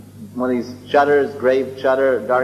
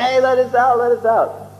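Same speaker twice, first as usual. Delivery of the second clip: calling out; 9 semitones higher than usual. Retold or imitated. Locked-in people calling out.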